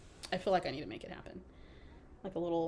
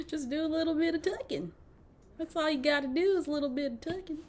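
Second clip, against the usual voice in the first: higher, amused Southern twang; fake Southern accent; 8 semitones higher than usual. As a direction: repeat it in a put-on Southern accent. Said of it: "I can fake a Southern accent".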